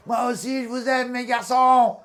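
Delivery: loud, high, slow shout to his sons; father shouting back to his sons from the sea, loud and slow; crying out. Retold or imitated. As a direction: yell it out to the cliff, drawn out. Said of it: "j'ai crié".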